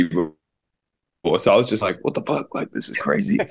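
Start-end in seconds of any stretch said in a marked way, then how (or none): 2.0-3.4 soft, high exclamation; retold hushed disbelief. Guest recalls seeing a first celebrity, hushed disbelief. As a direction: relive the shock in a small, high voice.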